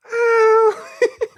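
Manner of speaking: high squealing laugh; a burst of high laughter. Real, not acted. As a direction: crack up, squealing. Cohost laughs at the teasing.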